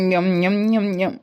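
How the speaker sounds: mock munching; playful eating sounds; chewing noises. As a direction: chomp on a big mouthful. Chewing while being fed.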